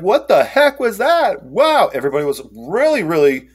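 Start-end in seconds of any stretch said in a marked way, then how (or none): 0.0-1.9 high, drawn-out disbelief; stunned reaction. Retold or imitated. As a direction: blurt it high, utterly baffled. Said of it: "was like".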